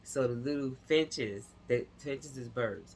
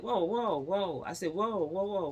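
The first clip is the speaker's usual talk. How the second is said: alarmed whoa; 6 semitones higher than usual. Retold or imitated. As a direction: throw up a hand and stop him cold. Stopping boss from selling tank.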